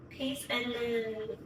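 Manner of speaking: signing off to viewers, slow; drawn-out sign-off. Real, not acted. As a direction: stretch it out warmly. Vlog sign-off.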